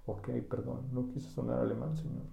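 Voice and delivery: soft, meek mock apology; quiet, sheepish "perdón". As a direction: shrink back and say sorry. Sheepish apology after scolding.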